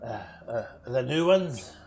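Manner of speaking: dim goblin guard. Acted.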